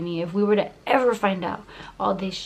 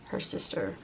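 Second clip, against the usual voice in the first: whisper; 11 semitones lower, 10 dB quieter than usual. Real, not acted. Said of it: "whispered reveal".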